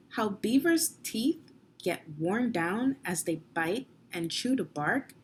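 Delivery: usual speaking voice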